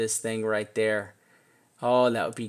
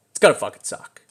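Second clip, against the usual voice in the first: louder, punched curse; emphatic; 3 semitones lower, 7 dB louder, faster than usual. Real, not acted. Blunt exasperated punchline to viewers about restarting the count. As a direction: hit fucking suck hard.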